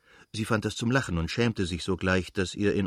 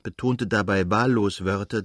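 ordinary delivery

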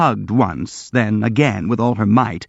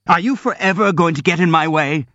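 roaring; 7 semitones higher than usual. A narrator's reading. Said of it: "roared Ganya".

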